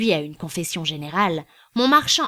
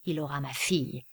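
grumbled aside; 5 semitones lower, 7 dB quieter than usual. A narrator's reading. César grumbling aside about his daughter. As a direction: lower and softer, under the breath. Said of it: "dit César en grommelant".

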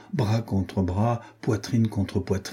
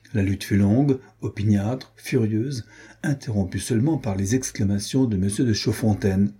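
ordinary delivery

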